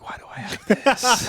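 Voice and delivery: high, laughing mock panic; laughing disbelief. Host retelling finding drugs next day, laughing disbelief. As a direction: squeak it out through laughter.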